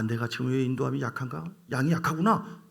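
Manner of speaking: usual speaking voice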